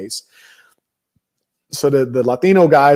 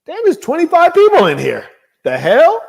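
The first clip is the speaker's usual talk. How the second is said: shocked; 14 semitones higher, 7 dB louder than usual. Retold or imitated. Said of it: "You're like".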